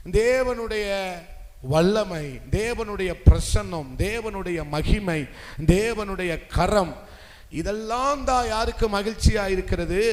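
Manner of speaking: loud, punched sermon delivery; forceful preaching. Real, not acted. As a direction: declaim each phrase, pausing between. Preacher building a list emphatically. (உரத்த, அழுத்தமான பிரசங்கம்)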